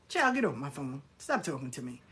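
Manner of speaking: low, soft brush-off; weary dismissal. Retold or imitated. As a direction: shut the caller down in a dropped, quiet voice. Dismissing unwanted phone reader.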